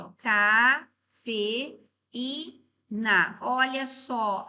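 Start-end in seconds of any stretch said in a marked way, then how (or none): 0.2-1.8 Cafeína said slowly, split as ca-fe-í-na; syllable by syllable, slow and clear; separated into syllables. Shown as a demonstration. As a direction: spell out the syllables for the class. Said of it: "Vamos separar a de baixo".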